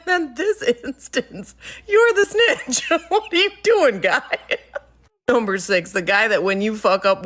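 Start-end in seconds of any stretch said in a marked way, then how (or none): 0.1-4.4 slow, laughing put-down; laughing retort. Retold or imitated. Boss laughing as she tells the snitch he is the snitch. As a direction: laugh through the comeback.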